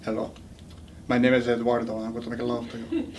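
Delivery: low, sultry mock Latin lover accent; soft, low comic Latin Casanova. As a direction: seduce in a hammed-up Latin accent. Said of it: "you look at that Latin accent".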